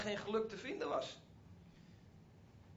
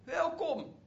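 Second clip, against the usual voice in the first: high, slow, joyful call of welcome; a father calling to his son from afar; drawn-out happy welcome, pitched high; 9 semitones higher, much slower than usual. Retold or imitated. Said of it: "hij begon al te roepen".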